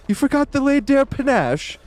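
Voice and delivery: mock Gustave shrieking at his bellboy; outraged high mock shriek. Gustave exploding at the bellboy, high outraged shriek. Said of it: "ranting at him".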